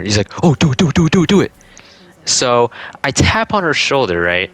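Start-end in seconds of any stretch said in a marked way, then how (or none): 0.4-1.5 high, rapid-fire mock urging; excited egging on. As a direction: imitate a giddy friend goading, higher pitched. Friend eggs on the prank.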